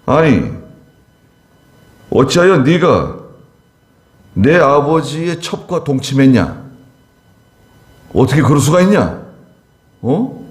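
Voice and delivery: a king scolding his general for the insult; mock rebuke. Son of Saul rebuking his general, mock scolding. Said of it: "책망했어요".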